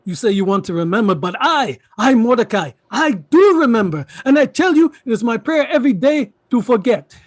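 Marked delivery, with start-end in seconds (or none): 1.4-7.1 louder, higher, fervent insistence; impassioned declaration. Acted. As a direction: build into a forceful personal testimony. Uncle's impassioned rebuke.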